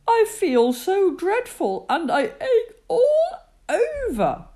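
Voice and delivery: high, whiny poorly bear; miserable sick bear. Acted. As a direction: complain like you ache everywhere.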